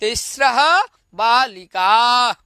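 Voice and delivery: put-on तीसरा बालिका spoken slowly for the children to copy; teacher's slow repeat-after-me; slow model answer. Shown as a demonstration. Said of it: "क्या बोलेंगे?".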